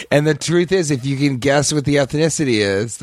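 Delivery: ordinary talk, nothing marked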